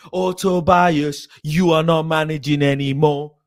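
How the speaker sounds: loud, forceful pronouncement; angry dismissal. Real, not acted. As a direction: sack them at the top of your voice. Sacking a manager in a rant.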